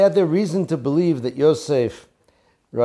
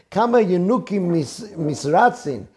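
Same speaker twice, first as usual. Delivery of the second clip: ordinary talk, nothing marked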